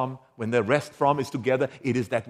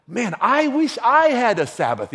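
raised, louder longing cry; wistful exclaim; 8 semitones higher, 5 dB louder than usual. Retold or imitated. A churchgoer longing for the Sabbath, higher and louder. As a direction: call out how much you want one. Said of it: "people will say".

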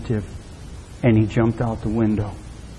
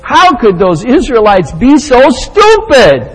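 higher, louder, drawn-out disbelief; incredulous scorn; 10 semitones higher, 7 dB louder, slower than usual. Acted. Preacher mimicking a reader judging the Israelites, incredulous scorn. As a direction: exclaim it slowly in loud disbelief.

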